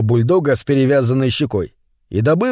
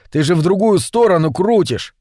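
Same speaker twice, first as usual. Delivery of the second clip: ordinary delivery, nothing marked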